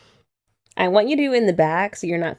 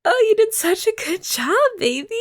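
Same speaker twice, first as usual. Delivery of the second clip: high, loud, gushing praise; shower him with proud praise; delighted praise; 3 dB louder than usual. Real, not acted. To her partner after he straightens her hair, gushing praise.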